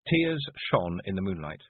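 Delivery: plain reading voice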